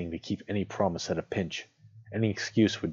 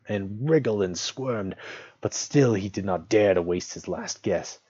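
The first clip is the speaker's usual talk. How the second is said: ordinary delivery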